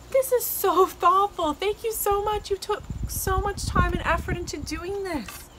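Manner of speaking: high, heartfelt gushing; gushing thanks. Real, not acted. Thanking a friend for time and effort, gushing.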